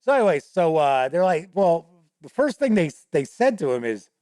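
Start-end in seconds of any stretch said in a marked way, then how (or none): none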